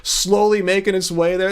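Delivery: normal talking voice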